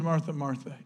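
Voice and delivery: soft, compassionate and low; a soft, compassionate call to Martha. Shown as a demonstration. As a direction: say the name gently, with compassion. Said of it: "meant biblically to show a soft tone".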